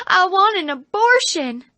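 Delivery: high, put-on whining stage voice; hammed-up complaint from the hospital bed; whiny actress.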